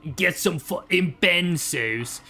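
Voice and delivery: mock English accent, half Australian; British geezer accent. Streamer mimicking a British guy on benzos, mock British accent. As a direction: put on a slow, hammy British geezer. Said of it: "a drug a British guy would take".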